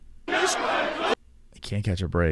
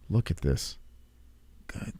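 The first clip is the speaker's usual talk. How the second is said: ordinary delivery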